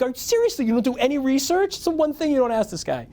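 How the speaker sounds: high, scolding rant; laying into him. Retold or imitated. Reporter laying into the clueless kid, high scolding. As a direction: berate the kid for no research. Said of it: "I'm like".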